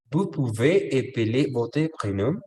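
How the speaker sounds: "Vous pouvez épeler votre prénom?" lento, con "vous" marcado y subida final; modelo lento. Shown as a demonstration. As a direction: dictar la pregunta despacio y claro. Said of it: "qué creen ustedes que significa esto". (profesor modela la pregunta lentamente para la clase)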